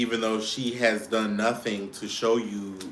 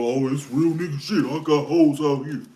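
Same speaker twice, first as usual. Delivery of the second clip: higher, puffed-up boast of a player; mock bragging man; 4 semitones higher, 5 dB louder than usual. Mocking men who brag about hoes, a hint of swagger. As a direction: swagger it out like a fake tough guy.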